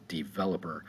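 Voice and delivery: "developer" with the accent on "vel"; stress on second syllable. Shown as a demonstration. Teacher showing where the accent is, "developer" stressed on its second syllable. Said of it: "over the second syllable".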